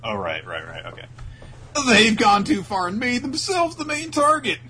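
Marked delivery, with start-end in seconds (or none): 1.9-4.6 announcer voice. Acted. Narrator line.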